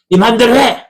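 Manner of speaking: high, sharp burst of "do as you please"; exasperated outburst. Acted. Fed-up parent telling a stubborn child to do as they please, exasperated outburst.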